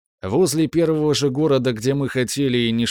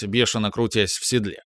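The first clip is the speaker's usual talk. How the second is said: ordinary delivery, nothing marked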